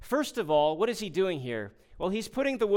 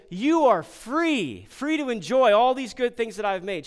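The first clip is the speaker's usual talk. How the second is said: lavish the freedom on them; generous God; 7 semitones higher, 8 dB louder than usual. Retold or imitated. Preacher voicing God granting freedom, generous and expansive. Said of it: "now notice the change in tone".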